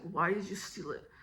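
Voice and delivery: low, soft condescending question; imitated teacher's condescending undertone. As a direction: talk down to a child, voice dropped. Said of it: "like, condescending".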